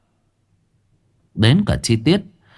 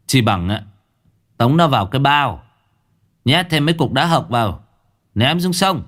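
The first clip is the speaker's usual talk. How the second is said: lightly voiced gangster threat; a hint of a cold menacing boss; 6 semitones higher than usual. A narrator's reading. Cậu Cẩn threatens in story. (giọng đe dọa nhẹ)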